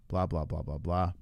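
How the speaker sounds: low, soft dismissive blah. Real, not acted. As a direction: brush it off lazily. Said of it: "dismissive filler".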